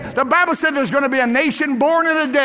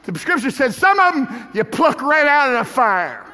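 usual speaking voice

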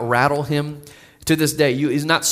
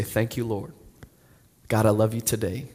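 murmured, much softer, lightly sing-song; soft sing-song prayer; 6 semitones lower, 6 dB quieter than usual. Retold or imitated. Old pastor praying under his breath down the hall, soft sing-song. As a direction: pray softly under your breath, half singing. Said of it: "in this kind of sing-song voice".

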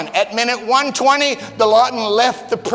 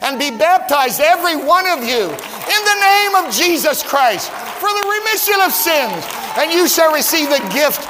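raised, ringing preacher's cadence; soaring pulpit delivery climbing higher on Christ; full-force preaching; 4 semitones higher than usual. Acted. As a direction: preach it out to the congregation.

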